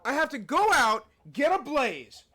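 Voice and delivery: ordinary talk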